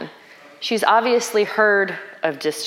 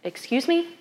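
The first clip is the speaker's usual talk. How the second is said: softer, higher-pitched disbelieving question; incredulous; 7 semitones higher, 5 dB quieter than usual. Acted. Preacher reacting to Jesus's reply, incredulous. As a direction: lift it into an affronted question.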